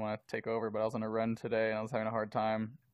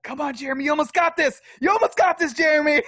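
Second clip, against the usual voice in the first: much higher, louder cheering; hype man; 11 dB louder than usual. Retold or imitated. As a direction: pump yourself up like a coach. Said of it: "my biggest hype man".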